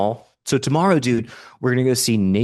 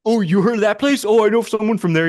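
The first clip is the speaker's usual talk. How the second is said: high, louder mock enthusiasm; imitated excited chatter; 13 semitones higher, 5 dB louder than usual. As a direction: gush like tipsy new friends. Acts excited place-naming with strangers.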